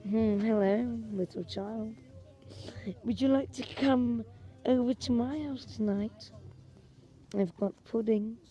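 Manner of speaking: a lightly put-on creepy stranger, soft and coaxing; creepy stalker voice. Stalker coaxing a child to his house, creepy and soft. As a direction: lure the child in, sly and hushed.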